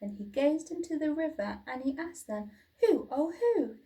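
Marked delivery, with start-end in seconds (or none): 2.8-3.8 much higher, amazed asking; wondering. A narrator's reading. Drip wondering at his own reflection. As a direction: gasp at the stranger in the water.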